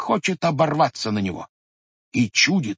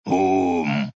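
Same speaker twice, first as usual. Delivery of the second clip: bear grunt; 6 semitones lower than usual. Acted. Bear echoing grandfather, low grunt. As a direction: lower growled ум. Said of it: "заревел медведь".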